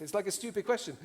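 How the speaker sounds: ordinary talk, nothing marked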